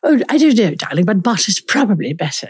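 playful put-on Queen's English. Shown as a demonstration. Host asks about the word choice, mock Queen's English reply. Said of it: "is that the Queen's English?".

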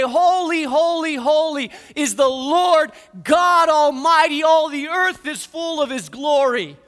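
ringing, awestruck praise; loud angelic proclamation. Retold or imitated. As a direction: call it out like a choir of angels. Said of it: "another angel would call out and say".

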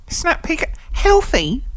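high-pitched disbelief; squeaky, disbelieving question; incredulous. Real, not acted. Disbelief at snack called healthy.

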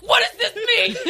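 high, loud, exasperated squawk; mad mock yell. Reading dad's text while mad, exasperated mock yell. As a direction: retell the anger as a laughing outburst. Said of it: "I was still so mad".

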